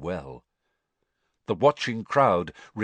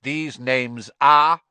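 louder, slow cutting demand; sharply; 6 semitones higher, 9 dB louder, slower than usual. A narrator's reading. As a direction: cut him off sharply. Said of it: "said Mister Crouch sharply".

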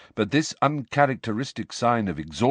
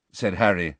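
plain reading voice

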